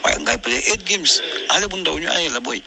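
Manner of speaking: usual speaking voice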